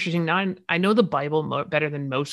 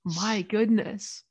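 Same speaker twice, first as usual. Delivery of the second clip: slow, higher-pitched pretend scandal; mock shock; 4 semitones higher, 3 dB louder, slower than usual. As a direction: draw it out in mock horror. Mocks the ladies' outrage.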